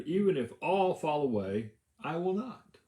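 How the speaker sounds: a mild mock outburst of loyalty; emphatic protest.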